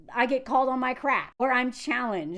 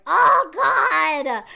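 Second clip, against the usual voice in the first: loud, higher mock-horrified cry; dismayed outburst; 4 semitones higher, 8 dB louder than usual. Vlogger reliving first hearing that accent, loud exaggerated dismay. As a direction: burst out in exaggerated dread. Acting out dread on hearing her.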